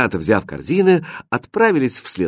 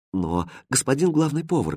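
soft, sorrowful appeal; sad; 3 semitones higher, 6 dB quieter than usual. A narrator's reading. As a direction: plead softly and sadly. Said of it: "печально сказала Катринка". (печально и тихо)

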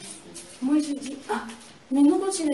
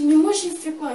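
panicked protest; 7 semitones higher, 11 dB louder than usual. Retold or imitated. Retold panicked protest at attacker.

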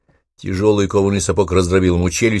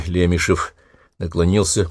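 ordinary delivery

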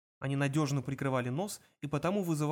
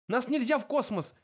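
high-pitched desperate plea; raised, urgent begging; pleading; 9 semitones higher, 4 dB louder than usual. A narrator's reading. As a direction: beg frantically. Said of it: "взмолился Форд".

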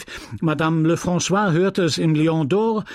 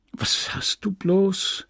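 higher, slow, worried question; worried asking; 7 semitones higher, slower than usual. A narrator's reading. Husband at his sick wife's bed, worried asking. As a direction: draw out a puzzled, worried question.

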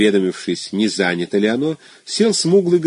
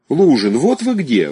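raised voice calling across a room; 6 semitones higher, 4 dB louder, slower than usual. A narrator's reading. The wife spotting Luzhin in the crowd, calling out. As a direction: hail him from afar. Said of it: "окликнул его голос жены".

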